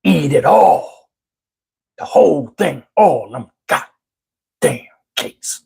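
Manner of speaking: a hint of a menacing grandfather, slowing down; stern granddad command. Granddad forcing his grandson to finish the cakes, slow and menacing. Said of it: "He was like".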